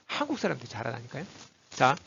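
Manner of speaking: usual speaking voice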